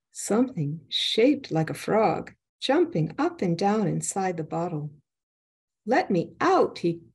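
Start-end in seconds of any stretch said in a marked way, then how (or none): 5.9-6.8 high, urgent call; trapped spirit exclaiming to the student. A narrator's reading. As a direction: blurt it out, pitched up.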